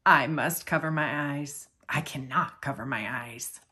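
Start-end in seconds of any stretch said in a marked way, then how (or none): none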